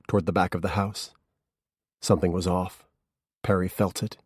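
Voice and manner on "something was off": ordinary delivery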